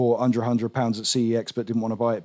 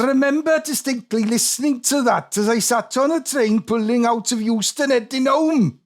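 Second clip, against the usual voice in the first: put-on higher, lilting Welsh accent; 10 semitones higher than usual. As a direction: read it in a sing-song Welsh accent. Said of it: "I can do a respectful Welsh accent".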